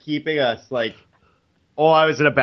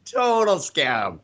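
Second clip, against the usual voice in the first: scoffing "scam"; 10 semitones higher, much slower than usual. Retold or imitated. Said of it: "And I'm like".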